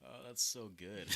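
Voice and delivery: normal talking voice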